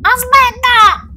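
high, loud, drawn-out squeal; mumbling child. A narrator's reading. Child with his nose held stalling the witch, high loud squeal. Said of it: "bofonchiò Pietro".

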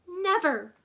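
loud, high, sharp outcry; fierce cry. A narrator's reading. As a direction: fling the refusal out full force. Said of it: "she cried".

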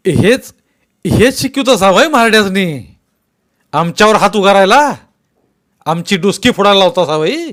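loud, high furious accusation; shouting. Acted. Patil accusing Bhagwanrao before the crowd, furious shout. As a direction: Patil yells the blame. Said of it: "Patil ओरडले".

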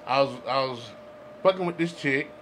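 ordinary talk

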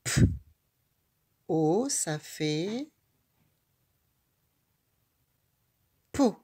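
"O, ça fait" slow and drawn, then "peau"; slow blending. Shown as a demonstration. Teacher to child reading the syllable: slow blending into "peau". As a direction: stretch the blend slowly before "peau". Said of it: "Quelle est cette syllabe?".

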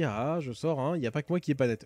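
ordinary talk, nothing marked

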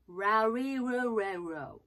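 ラリルレロ slowly with rounded English R; English R sounds. Shown as a demonstration. Coach showing learners how R sounds, slow English-R ラリルレロ. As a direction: glide through ラリルレロ, tongue never touching. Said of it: "こんな感じになります".

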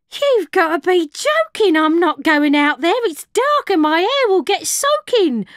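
high, loud protesting barmaid; piping barmaid. A narrator's reading. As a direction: pipe up in loud refusal. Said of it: "Mabel piped up".

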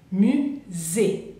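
"musée" slowly, the s buzzed as z; careful French "musée" with a z sound; French pronunciation model. Shown as a demonstration. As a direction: model "musée" as mu-zé, syllables apart. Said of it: "la S es como una Z".

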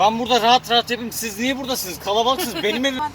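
shouting. Real, not acted. Telling the others to get out, shouting. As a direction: shout them out of your space. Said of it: "Bağırma ha. Bağırma anneme".

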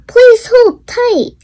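high, loud announcer call over the speaker; train speaker announcement. A narrator's reading. Train speaker tells riders to hold tight, loud high announcement. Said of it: "A voice on the speaker said".

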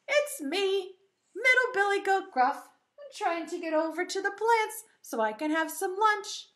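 high, eager billy goat voice; middle goat. Acted. Middle goat explaining himself to troll, high goat voice. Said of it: "the middle billy goat Gruff said".

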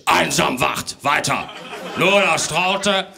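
loud, scolding Father Christmas; a strict Santa telling off the parents; stern Santa. Acted.

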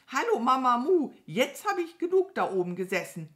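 laute, hohe Krähenstimme; aufgeregte Krähe. Acted. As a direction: hastig und laut losplappern. Krähe kommt hastig angeflogen. (aufgeregt krähend)

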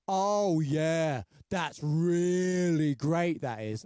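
slow, drawn-out mock enthusiasm; sarcastic. Shown as a demonstration. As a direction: stretch the praise out sarcastically. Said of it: "sarcasm can be mis-, uh, misunderstood".

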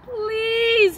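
pleading please; begging. A narrator's reading. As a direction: beg for the shoes. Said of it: "he begged".

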